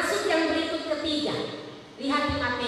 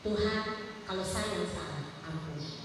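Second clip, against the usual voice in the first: soft, humble low confession; softened heart; 5 semitones lower, 7 dB quieter than usual. Shown as a demonstration. Believer humbly asking God's pardon, softly and low. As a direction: let the heart go soft and ask forgiveness. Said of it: "lembutkan hati".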